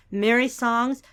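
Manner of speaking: ordinary delivery, nothing marked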